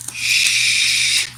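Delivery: long, soft "shh"; hushing shush. Pretend. Vlogger hushes viewers about a forbidden topic, hushing. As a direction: hush them with a drawn-out shush. Mock secrecy about an unnamed thing.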